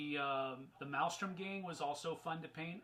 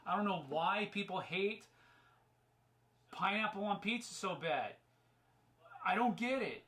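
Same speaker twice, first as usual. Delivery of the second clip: ordinary talk, nothing marked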